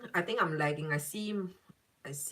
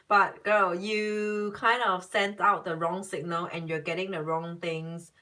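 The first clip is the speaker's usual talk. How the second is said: ordinary talk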